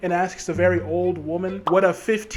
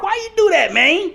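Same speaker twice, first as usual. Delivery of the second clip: much higher, louder mock jive voice; 12 semitones higher, 5 dB louder than usual. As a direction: squeal it in a put-on street voice.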